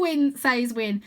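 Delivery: usual speaking voice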